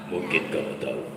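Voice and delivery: deep, low, suspicious voice; menacing king. Shown as a demonstration. King Namrud suspects his soldier, deep and menacing. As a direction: lean in with threat. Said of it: "king presses soldier".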